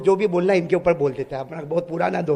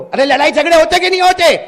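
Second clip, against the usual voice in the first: high loud rhetorical demand; shouted question to the congregation; loud challenge; 9 semitones higher, 11 dB louder than usual. Acted. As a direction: throw it at the audience. Preacher challenges the crowd.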